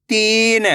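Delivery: तीन with the ee stretched long; तीन, held long for learners; drawn-out long vowel. Shown as a demonstration. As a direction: pull out the ee in तीन. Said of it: "तो आपको खींच के पढ़ना है".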